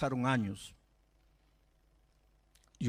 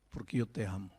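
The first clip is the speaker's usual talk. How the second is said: tender, low confession; 8 semitones lower, 4 dB quieter than usual. Retold or imitated. Pastor telling boy he loves him.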